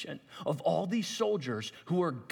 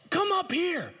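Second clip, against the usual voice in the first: taunting call; 13 semitones higher, 3 dB louder, slower than usual. Acted. Philistines taunting from above.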